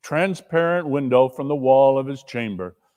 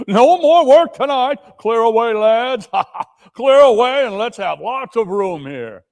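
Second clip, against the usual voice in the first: much higher, loud hearty call; jolly hail; 7 semitones higher, 5 dB louder than usual. Acted. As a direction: greet the boy with gusto. Fezziwig rousing apprentices.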